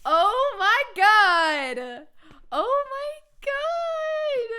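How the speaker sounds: slow, stretched amazement; awed, drawn-out oh my god; drawn-out disbelief. Real, not acted. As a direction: let it sink in slowly. Stunned after winning.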